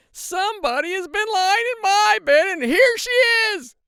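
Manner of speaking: piercing little bear shriek; shrill, very loud cub squeal, stretched out at the end; Baby Bear. A narrator's reading. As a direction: scream it out as a tiny outraged bear. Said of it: "said Baby Bear in his little shrill voice".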